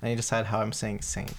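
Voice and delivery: usual speaking voice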